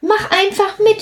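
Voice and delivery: high little drop voice, coaxing; urging. Acted. Platsch urges Plitsch to jump with him, high and coaxing. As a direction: coax your friend to jump along, pitched high. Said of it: "forderte er Plitsch auf".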